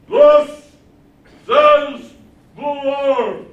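booming, high, drawn-out horn proclamation; prophet through horn. Acted. False prophet blaring through his iron horn, mock proclamation. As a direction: blare it out like a mock megaphone oracle. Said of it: "Through this iron horn".

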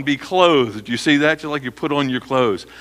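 ordinary talk, nothing marked

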